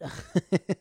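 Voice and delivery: short loud laugh; quick amused cackle; burst of laughter. Real, not acted. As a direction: laugh out at your own joke. Laughs at own joke.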